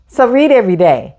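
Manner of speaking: plain reading voice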